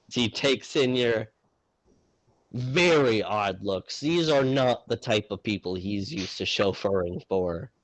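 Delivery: normal talking voice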